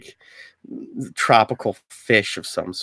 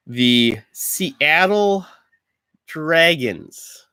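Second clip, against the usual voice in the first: slow, higher sports announcer call; 4 semitones higher, much slower than usual. Pretend. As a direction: stretch the name out grandly. Announcing team names.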